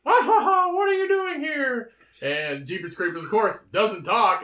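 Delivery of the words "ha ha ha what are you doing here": high, loud clown cackle; Pennywise voice. Acted. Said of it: "So he goes".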